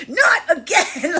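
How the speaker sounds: a touch of fed-up anger; mock exasperated. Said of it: "the anger of someone being like".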